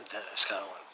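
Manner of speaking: usual speaking voice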